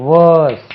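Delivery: "வேர்ஸ்" loud, long vowel and silent r; careful model pronunciation. Shown as a demonstration. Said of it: "இதுக்கு உச்சரிப்பை கவனிக்கணும்". ("வேர்ஸ்" என்பதில் ர் ஒலிக்காமல் நீண்ட உயிரொலி)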